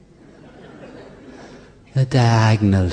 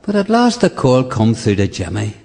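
ordinary talk

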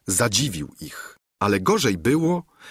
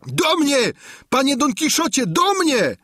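high-pitched cry for help; frightened shout; 4 dB louder than usual. A narrator's reading. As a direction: call your master over in alarm. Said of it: "zawołał".

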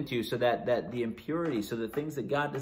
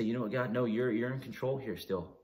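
softer, lower, private prayer; quiet self-reassurance; 3 semitones lower, 7 dB quieter than usual. Retold or imitated. Preacher telling God what he tells himself when anxious, quietly reassured. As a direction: say it softly, as if talking yourself down.

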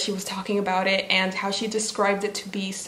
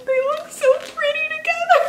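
delighted. Real, not acted. Admiring books side by side.

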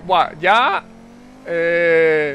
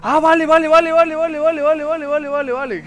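high, loud rapid okays; excited relief; 7 semitones higher, 6 dB louder than usual. Real, not acted. Gamer finding the hidden route, excited. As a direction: burst out as it clicks.

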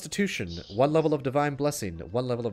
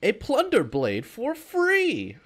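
slow, higher, louder sales pitch; home shopping host; 7 semitones higher, 8 dB louder, slower than usual. Pretend. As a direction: push the free bonus offer. Advert closing offer.

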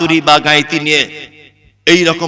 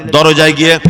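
usual speaking voice

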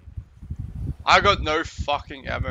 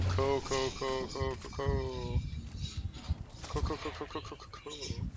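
fading mumble; 11 dB quieter than usual. Pretend. Fading repeated cool.

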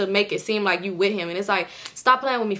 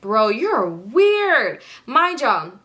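very slow, stretched-out mock disbelief; exasperated disbelief; 3 dB louder, much slower than usual. Irritated passenger judging the driver, drawn-out exasperated disbelief. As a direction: drag out the last word in exasperation. Acting out her irritation at him.

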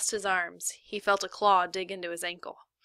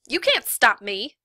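loud, slow, defiant snap; defiant loud snap at the guard; snapped; 4 semitones higher, 9 dB louder, slower than usual. Acted. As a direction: cut him off hard. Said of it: "she snapped".

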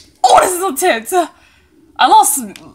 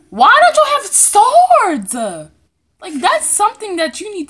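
exasperated; 11 semitones higher, 11 dB louder than usual. Real, not acted. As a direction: scold in disbelief.

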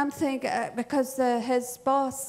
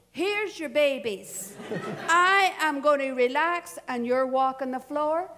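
playfully indignant, rehearsed retort; mock telling-off. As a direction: lay down the law, half in jest. Rehearsed retort to absent husband.

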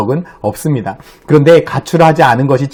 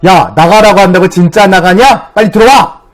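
retold parental telling-off, louder and higher; scolding; 5 semitones higher, 5 dB louder than usual. Dad calling his daughter back inside, retold angry scolding. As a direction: scold like an exasperated dad, building to a raised command. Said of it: "하고 또 혼내기 시작했습니다".

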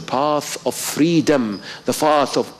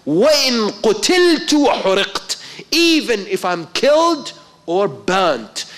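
fervent vow; 8 semitones higher than usual. Acted.

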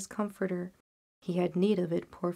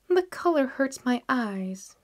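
mother's voice; 4 semitones higher, 4 dB louder than usual. A narrator's reading. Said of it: "she said".

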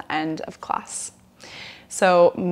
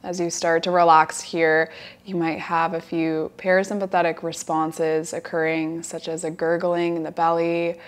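guided relaxation. Acted. Yoga teacher guiding students lying down to relax, guided relaxation. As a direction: settle them gently into the breath, unhurried.

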